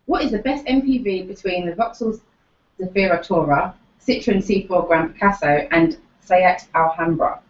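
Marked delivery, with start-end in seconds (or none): none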